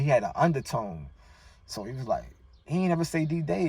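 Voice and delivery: ordinary delivery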